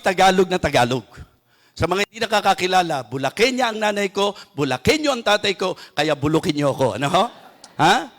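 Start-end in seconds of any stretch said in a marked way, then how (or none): none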